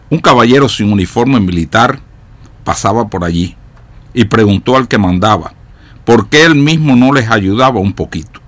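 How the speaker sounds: usual speaking voice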